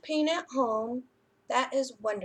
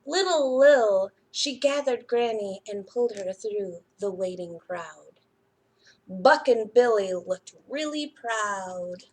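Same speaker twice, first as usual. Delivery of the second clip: put-on lively storybook reading to children; picture book reading; 3 dB louder than usual. A narrator's reading. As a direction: read it like a bedtime story for little ones.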